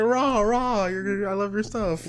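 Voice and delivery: high, hammed-up fangirl gushing; mock her with a high rah-rah squawk; exaggerated high-pitched impersonation. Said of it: "She's like".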